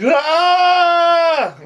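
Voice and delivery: sudden high shriek of shock; freaking out. Retold or imitated. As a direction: let out a loud panicked yelp. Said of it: "Just freaks out".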